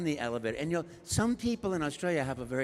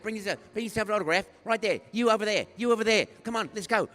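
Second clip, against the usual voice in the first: raised, rapid-fire orders; bossy directing; 5 semitones higher, 7 dB louder, faster than usual. Retold or imitated. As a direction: imitate an agent herding a crowd. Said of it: "she's, like, directing everybody".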